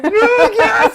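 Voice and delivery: high, loud answer breaking into laughter; laughing mock answer. Giving up on the phone bot, laughing high and loud. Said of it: "And you're like".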